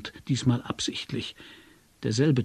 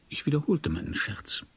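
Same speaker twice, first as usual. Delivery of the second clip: usual speaking voice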